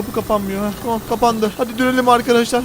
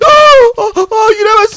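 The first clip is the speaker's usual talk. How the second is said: much higher, louder scream; terrified scream; 10 semitones higher, 9 dB louder, slower than usual. Acted. Player screams as caveman tries to get in. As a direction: shriek as the door is forced.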